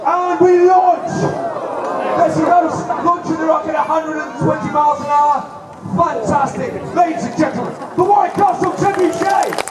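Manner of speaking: high, loud showman announcement; excited announcer. Acted. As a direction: proclaim it like a ringmaster. Announcing the launch.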